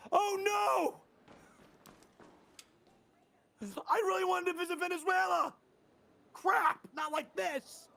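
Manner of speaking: high, wailing mock despair; hammed-up doomed passenger crying out; acted panic. Shown as a demonstration. Voice actor improvising a plane crash, hammed-up panic. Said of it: "I can do emotion".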